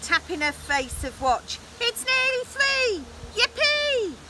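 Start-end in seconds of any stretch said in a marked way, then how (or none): 1.8-4.2 very high, gleeful witch; excited witch cry. A narrator's reading. Witch cheering as the party nears, excited squeal. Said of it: "she cried".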